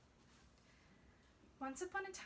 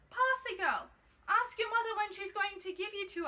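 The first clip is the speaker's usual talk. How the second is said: higher, louder call across the convent wall; 6 semitones higher, 5 dB louder than usual. A narrator's reading. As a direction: call out to the girl from afar. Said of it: "called out to her".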